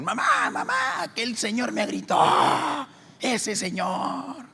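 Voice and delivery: put-on girl telling mom, high and slow; little girl tattling. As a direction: whine like a kid telling on someone. Girls telling mother, voiced.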